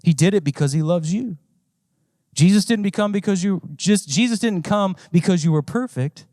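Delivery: usual speaking voice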